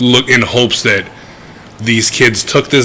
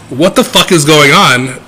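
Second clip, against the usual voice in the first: ordinary talk, nothing marked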